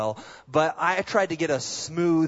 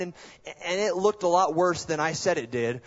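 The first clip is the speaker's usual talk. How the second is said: ordinary delivery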